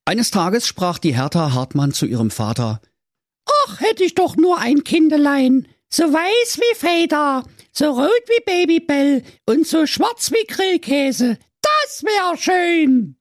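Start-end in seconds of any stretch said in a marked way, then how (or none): 3.5-13.1 put-on high, pleading girl voice; whiny teenage daughter. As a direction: play the spoiled fairy-tale daughter wishing aloud. Fairy tale daughter's lines.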